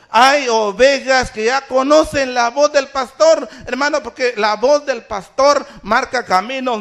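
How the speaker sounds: high, loud, driving sermon voice; full-force preaching. Real, not acted. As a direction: proclaim it phrase after phrase to the congregation. Preacher proclaims to congregation.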